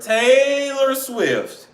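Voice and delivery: high, drawn-out name, calling her out; mock scolding. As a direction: stretch the name like a disappointed scolding. Mock scolding of absent [name].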